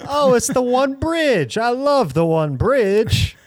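high, loud mock New York accent, gushing; hammed-up New Yorker raving about Times Square. Mocking New Yorkers loving their city, New York accent. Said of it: "my New York accent's getting way worse".

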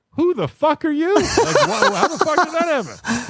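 high squeaky incredulous outburst; stunned disbelief. Retold or imitated. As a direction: squeal it like you can't believe your eyes. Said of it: "And they're all like".

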